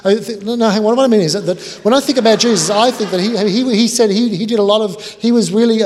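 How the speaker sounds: high, loud floundering; hammed-up high, loud stammer; stammering guest. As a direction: flail for words loudly. Guest stammers about Jesus.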